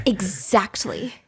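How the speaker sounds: slow smug agreement. Retold or imitated. Drawn-out Zizi agreement.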